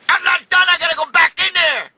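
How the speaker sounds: high-pitched, shouted quote; urgent insistence. Retold or imitated. Mother-in-law insists mid-argument.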